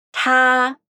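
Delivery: breathy puffed t; Tá with a puff of air, Mandarin tā; aspirated tā. Shown as a demonstration. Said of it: "trouxemos a palavra ele".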